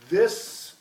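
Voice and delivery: "This." with tongue between the teeth. Shown as a demonstration. Teacher modelling "This." for the class, tongue between teeth. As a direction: model the th in "This." clearly. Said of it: "do what I do".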